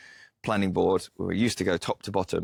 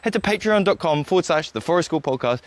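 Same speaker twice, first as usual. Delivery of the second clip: louder, higher, upbeat promo read; podcast support appeal; 4 semitones higher, 5 dB louder than usual. Real, not acted. Host asking listeners to support on Patreon, upbeat announcer read. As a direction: pitch it brightly to the listeners.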